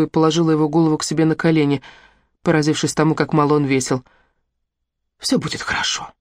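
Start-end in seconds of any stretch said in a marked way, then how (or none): 5.2-6.1 soft whispered comfort to a dying man; reassuring whisper. A narrator's reading. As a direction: whisper it gently, steady and sure. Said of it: "В моих словах слышалась уверенность". (ободряющий шёпот умирающему)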